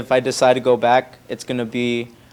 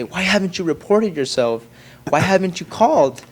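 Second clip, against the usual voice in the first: higher-pitched mock scolding; mad parents; 6 semitones higher than usual. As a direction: imitate parents demanding an explanation. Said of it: "mad and they say".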